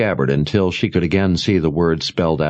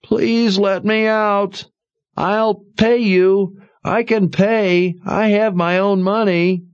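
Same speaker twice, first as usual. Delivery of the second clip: high, slow, desperate begging; muffled pleading; slower than usual. A narrator's reading. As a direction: plead from under the rock. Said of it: "came a muffled voice from under the rock".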